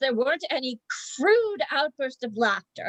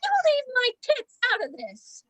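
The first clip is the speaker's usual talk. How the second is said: high-pitched indignant objection; indignant protest; 8 semitones higher than usual. Acted. Member objects to being used as bait, indignant protest. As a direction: object sharply, pitch jumping up.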